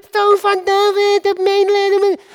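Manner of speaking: high, carrying cry for mercy; calling out. Acted. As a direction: shout it over the crowd, pitched high. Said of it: "En dan ga je lopen schreeuwen".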